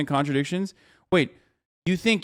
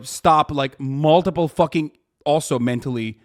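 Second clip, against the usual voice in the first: ordinary talk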